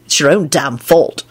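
low, restrained anger; mildly angry, pitched low; angry reply. A narrator's reading. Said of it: "Lupin replied angrily".

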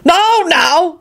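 high, soothing interruption; calm them down; reassuring. Acted. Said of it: "Arthur broke in reassuringly".

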